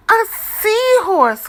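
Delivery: high, loud delighted cry; joyful cry. Acted. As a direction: cry out with joy. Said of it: "cries Pete".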